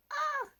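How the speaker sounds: excited squeal. Real, not acted. Squeal at the handwriting.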